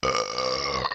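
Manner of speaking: deep burp after soda; low drawn-out belch. Real, not acted.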